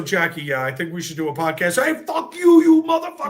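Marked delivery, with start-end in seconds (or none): 1.8-3.3 high, loud mock swearing at a friend; loud, high playful cursing. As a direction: yell it in playful fake anger. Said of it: "nonstop back and forth".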